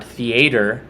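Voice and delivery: "theater" as "thee-AY-ter", long a; rejected pronunciation. Shown as a demonstration. Showing viewers what not to say, "theater" as "thee-AY-ter". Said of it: "not theater".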